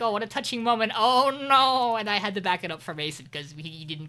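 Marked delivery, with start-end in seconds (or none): 0.0-2.0 gushing that builds into a loud, high cry; loud, high-pitched exaggerated reaction; mock dismay. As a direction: re-enact a touched reaction turning to alarm. Re-enacts own reaction to scene.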